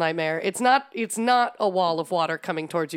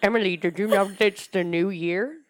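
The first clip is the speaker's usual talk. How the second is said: Father Time voice. Pretend. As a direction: play a creaky old Father Time. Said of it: "my name is Father Time".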